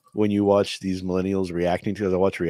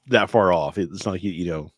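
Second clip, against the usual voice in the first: usual speaking voice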